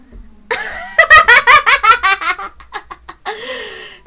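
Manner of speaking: high, loud mad cackle; villain cackle. Acted. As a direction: cackle like a gleeful killer.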